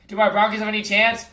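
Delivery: usual speaking voice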